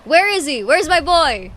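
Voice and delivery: loud, high-pitched excited shout; excited calling out. Real, not acted. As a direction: call out eagerly for a favorite.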